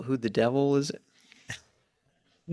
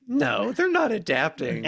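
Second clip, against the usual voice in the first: high-pitched retold denial; mock disbelief; 11 semitones higher than usual. Co-host retelling hearing the news, high incredulous denial. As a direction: act out your own disbelieving reaction, pitched well up.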